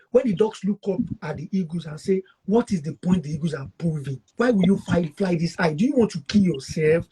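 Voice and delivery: ordinary talk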